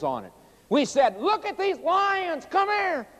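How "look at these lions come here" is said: put-on very high, slow, urgent kid's call; acted-out high-pitched excitement; excited schoolchildren calling. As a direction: imitate a kid begging teacher to come see. Retold children urging teacher to look.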